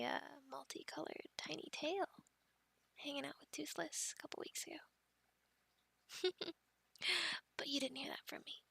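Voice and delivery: hushed, secretive aside; very soft, conspiratorial near-whisper; confidential. Acted. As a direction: let a secret slip under your breath.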